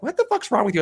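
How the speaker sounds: wife irritated by his joke, high mock voice. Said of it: "she's just like".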